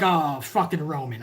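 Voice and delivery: low, soft grumble; underwhelmed and deflated; unimpressed fans. Retold or imitated. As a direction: imitate a let-down crowd groaning at his arrival. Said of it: "We're fucking like".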